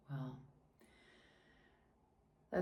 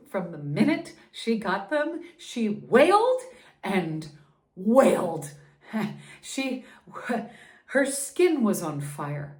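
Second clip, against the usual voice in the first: anguished telling; 3 semitones higher, 8 dB louder than usual. Acted. Baby suffering after vaccine shots.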